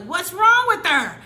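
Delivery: mother asking what's wrong, loudly worried; worried mom. Retold or imitated. Mom sees crying daughter.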